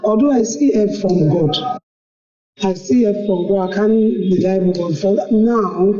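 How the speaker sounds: ordinary talk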